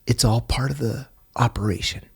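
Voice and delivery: hushed, breathy whisper, almost no voice. Retold or imitated. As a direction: whisper it like a secret between you two. Hushed reassurance to confused father.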